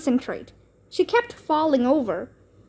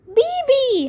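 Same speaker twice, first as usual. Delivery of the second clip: put-on very high, loud toddler holler; 13 semitones higher, 4 dB louder than usual. A narrator's reading. Toddler Kalia hollers for Bean, very high and loud. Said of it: "hollered Kalia".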